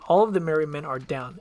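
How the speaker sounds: usual speaking voice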